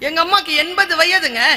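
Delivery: ordinary talk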